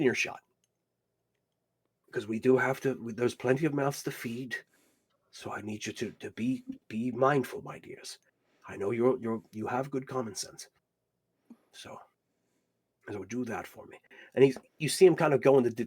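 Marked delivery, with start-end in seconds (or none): 2.1-14.0 lightly voiced kindly old man, softer at the ends; gentle old guardian. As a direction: give the kids their errand quietly. Old man instructs his children gently.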